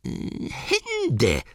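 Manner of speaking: high creature voice; Gollum's guess. Acted. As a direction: squeak the guess out. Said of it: "sagte Gollum". (hohe Gollum-Stimme)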